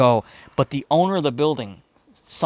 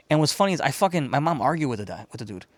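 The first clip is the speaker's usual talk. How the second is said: ordinary talk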